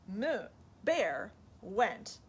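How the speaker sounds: plain reading voice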